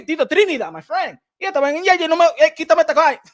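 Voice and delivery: hammed-up fighter ranting about his image; exaggerated Michel Rivera impression; imitating Michel Rivera. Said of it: "he be like".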